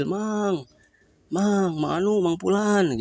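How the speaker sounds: high calls down the well; calling out. Acted. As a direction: call the name into the well.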